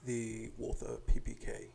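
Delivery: put-on slow, measured British accent. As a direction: answer like a suave British spy. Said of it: "Dang, British accent!".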